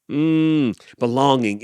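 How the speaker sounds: ordinary delivery, nothing marked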